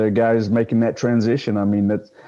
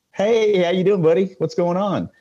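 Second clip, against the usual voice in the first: much higher, overly chummy hello to hide the confusion; fake cheerful greeting; 9 semitones higher than usual. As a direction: play it up, bright and friendly to a stranger. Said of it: "I'm looking at him like".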